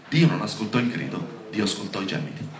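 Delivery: ordinary talk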